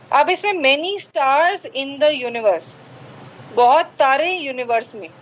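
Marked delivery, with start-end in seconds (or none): 0.5-2.8 reading back slowly. Shown as a demonstration. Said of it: "teacher reads pupil's sentence".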